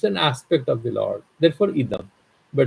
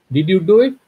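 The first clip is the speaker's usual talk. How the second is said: a louder, higher demanding question; mock scolding mom; 6 semitones higher, 5 dB louder than usual. Mother confronting her son, in a loud, scolding voice.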